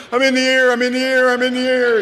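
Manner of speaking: high, loud, frantic little puppy; a puppy panicking in midair; panicked puppy voice. Retold or imitated. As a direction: squeal it like a scared pup lifted off the ground. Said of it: "he'll be like".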